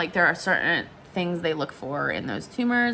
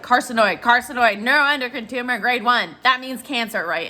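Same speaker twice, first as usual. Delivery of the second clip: loud, raised, panicked rattling-off; hysterical; 4 semitones higher, 5 dB louder than usual. Retold or imitated. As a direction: re-enact a frantic call to mom.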